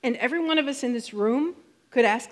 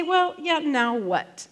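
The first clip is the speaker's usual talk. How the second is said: skeptical objection; 7 semitones higher, 6 dB louder, slower than usual. Retold or imitated. Listener's voiced skeptical objection.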